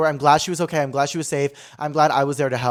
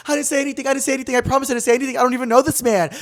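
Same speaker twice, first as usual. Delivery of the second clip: high, insistent protesting; imitating a flustered girl defending herself; retold frantic denial; 9 semitones higher, 3 dB louder, much faster than usual. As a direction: plead innocence in a higher voice. Retold girl denying the accusation.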